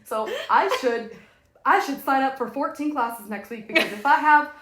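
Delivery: high, loud, giddy mock planner; hammed-up overeager inner voice; mock manic self. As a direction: hype yourself up into a frenzy. Mocking her overscheduling self.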